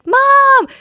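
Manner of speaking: high, loud mock whine; whiny kid calling mom. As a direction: whine for mom like a seven-year-old. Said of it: "That's what he sounds like".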